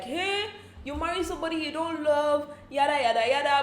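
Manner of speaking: sing-song scolding chorus; a hint of nagging gossip; mock critics. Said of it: "people outside were like".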